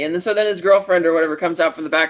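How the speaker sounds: ordinary talk, nothing marked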